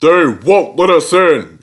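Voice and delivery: grumbled through the teeth, mildly; mild cross hiss. A narrator's reading. Big engine complains crossly to the controller. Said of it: "hissed the big engine crossly".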